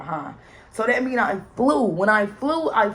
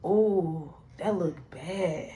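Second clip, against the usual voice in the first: mother's reaction; 3 semitones lower, 4 dB quieter, much slower than usual. Retold or imitated. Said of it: "and she said".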